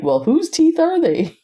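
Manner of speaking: playful whose-teeth-are-they; mock bafflement. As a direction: ask it in amused disbelief. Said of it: "It's like".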